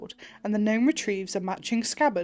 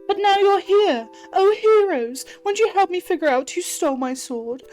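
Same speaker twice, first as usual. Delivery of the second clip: a tiny mouse hamming up her fear; squeaky mouse begging the heroes, melodramatic; high dramatic mouse; 5 semitones higher than usual. Acted.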